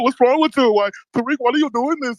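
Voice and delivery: high, mock-pleading whiner; whining mockery. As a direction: mimic a caller griping to the host. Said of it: "Man, stop whining".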